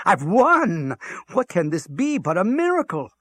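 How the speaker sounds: high, loud, exultant disbelief; incredulous. A narrator's reading. Hitler marveling at his bingo win, incredulous. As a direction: cry out in amazement at the win.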